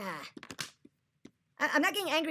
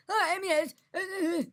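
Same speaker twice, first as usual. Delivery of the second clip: mock stammer; 6 semitones higher than usual. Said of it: "You would say".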